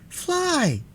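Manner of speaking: high-pitched, eager order; eager command. A narrator's reading. Boy ordering the Gump to fly, eager command. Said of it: "commanded Tip eagerly".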